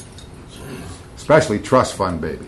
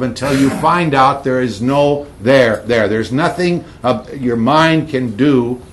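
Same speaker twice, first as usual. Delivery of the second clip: louder, driving preacher's voice; fervent sermon delivery, building conviction; preaching; 5 semitones higher, 6 dB louder than usual. Acted. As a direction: press the point hard like a preacher at full force. Preaching at full force.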